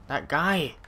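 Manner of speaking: sudden high startled cry; alarmed exclamation. Real, not acted. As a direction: blurt it out, caught off guard. Alarmed at approaching enemy.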